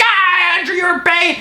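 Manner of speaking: high squeaky mock mom. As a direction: ham up a doting mother. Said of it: "their reactions are nasty".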